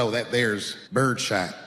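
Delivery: lightly voiced slow country clerk; drawn-out, low-key store clerk; gun clerk impression. Clerk answering a customer about ammunition, slow country clerk. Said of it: "he goes just like this".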